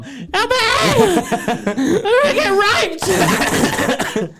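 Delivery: high-pitched, loud mock cry for help, breaking into laughter; mock panic. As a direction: squeal it like a hammed-up victim. Acts own panicked cry for help.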